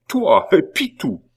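high, loud, disbelieving question; incredulous outcry. A narrator's reading. Abbé cannot believe it of Pitou, loudly astounded. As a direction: throw it at him in astonishment.

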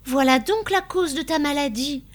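high, loud exclamation; crying out. A narrator's reading. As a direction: exclaim it as a sudden realisation. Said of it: "s'écria Constance".

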